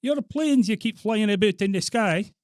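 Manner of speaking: high, loud complaining Scot; hammed-up Scottish outrage on a prank call; Scottish accent. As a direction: wail the complaint in mock Scots. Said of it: "You putting on a Scottish accent?".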